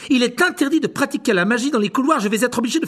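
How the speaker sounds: high, loud prefect order; scolding. Acted. Percy dispersing the crowd of students, high loud scolding. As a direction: shoo them to class, loudly.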